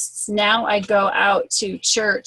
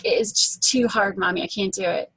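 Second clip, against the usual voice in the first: ordinary talk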